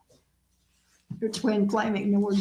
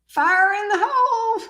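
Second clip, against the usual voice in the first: mock call-out; 9 semitones higher than usual. Joke call-out.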